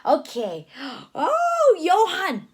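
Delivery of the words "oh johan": plain reading voice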